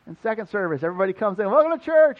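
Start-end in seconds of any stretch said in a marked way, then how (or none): 1.5-2.2 bigger cheery greeting. Retold or imitated. Mock greeting, second service.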